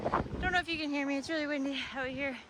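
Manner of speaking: panting against the wind; breathless, fast and high; out of breath in the wind. Real, not acted. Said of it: "I'm breathing hard".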